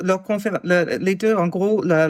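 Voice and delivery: ordinary talk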